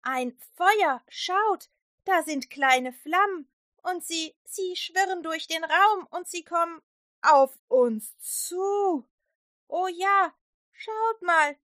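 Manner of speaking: high, loud, wondering voices; excited children's-story disciples; amazed disciples. Acted. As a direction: marvel at the flames overhead.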